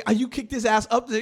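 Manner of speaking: high, loud amazed question; surprised, animated. Retold or imitated. Asking his friend about winning the fight, animated surprise. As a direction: ask it bursting with excitement. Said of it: "so surprised and animated about it".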